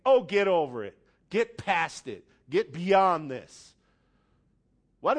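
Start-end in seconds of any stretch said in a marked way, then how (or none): 0.0-3.7 louder, curt brush-off; mock-dismissive get over it, louder and slowing at the end. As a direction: wave away someone's hurt, impatiently. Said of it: "instead of saying".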